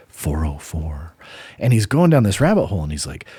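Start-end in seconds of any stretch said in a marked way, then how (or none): 0.1-1.1 hushed, slow whisper of "four oh four". Retold or imitated. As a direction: breathe "four oh four" out as a voiceless aside. 404 error again, whispered aside.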